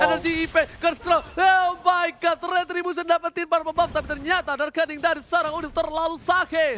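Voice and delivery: loud, high teamfight call; excited play-by-play. Acted. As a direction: shout the fight as it happens. Live teamfight commentary.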